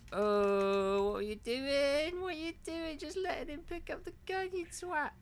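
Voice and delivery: high-pitched exasperated whine. Real, not acted. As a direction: squeak it out in disbelief. Exasperated at AI partner.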